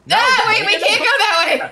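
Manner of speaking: laughing shout. Real, not acted. Panicked laughing shout in game.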